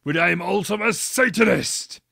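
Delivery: high, loud dramatic declaration; hammed-up angry reveal; Hagrid's defiant satanic confession. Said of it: "Hagrid paused angrily".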